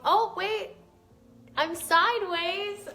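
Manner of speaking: sudden high, loud surprise; a real startled discovery, voice jumping up; surprised realization. Real, not acted. Streamer learning from a viewer that she is sideways, surprised.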